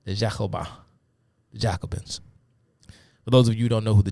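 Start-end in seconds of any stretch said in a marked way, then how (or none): 0.0-0.7 French accent. Shown as a demonstration. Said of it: "the best French accent I can muster".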